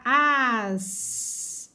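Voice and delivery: AS drawn out, long A into a held S; vowel-first reading. Shown as a demonstration. Teacher reading vowel before S, sounded-out "as". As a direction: stretch "as" slowly, letting the S trail on. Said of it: "começar a ler pela vogal".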